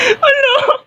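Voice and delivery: very high theatrical sobbing; hammed-up crying. Student sure they will all die, crying despair. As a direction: wail hopelessly through sobs.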